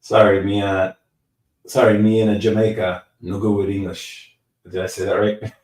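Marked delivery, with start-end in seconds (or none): 0.0-4.4 a hint of a Jamaican accent, half joking; Jamaican accent impression. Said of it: "his Jamaican accent is on point".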